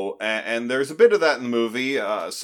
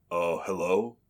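lightly acted, low and quiet answering message; prank voicemail greeting; 4 semitones lower, 7 dB quieter than usual. [name] recording a trick voicemail for callers, low-key greeting. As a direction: murmur a joke voicemail, pitched down.

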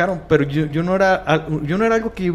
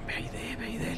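hushed urgent whisper; retold whisper to his wife; 7 semitones higher, 15 dB quieter than usual. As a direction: whisper it so nobody else hears. Husband urges wife during possession.

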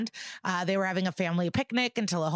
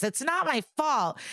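mock scream; 7 semitones higher than usual. Podcaster retells the girl screaming at the detective, mock scream. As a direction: imitate a screamed denial, pitch up. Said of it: "she screams".

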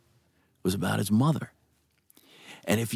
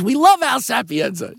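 high, loud retold enthusiasm; gushing praise; 12 semitones higher, 6 dB louder than usual. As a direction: gush as if quoting a big fan.

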